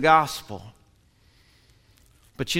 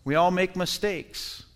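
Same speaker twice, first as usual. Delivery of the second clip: normal talking voice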